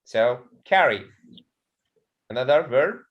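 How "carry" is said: "carry" with the weight on CAR; "carry" falling away after a stressed CAR; stress on first syllable. Shown as a demonstration. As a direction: model CAR-ry for the class. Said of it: "the stress is the first".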